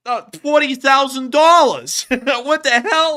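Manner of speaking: high, loud, slowing into incredulous yelling; mock outrage. Streamer disputing his fake CIA paycheck, mock outrage. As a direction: play up indignant disbelief, voice way up.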